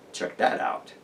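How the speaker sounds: low, soft whisper to the viewer; whispered aside. Real, not acted. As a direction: drop into a hushed, secretive whisper. Aside after the hybrid claim.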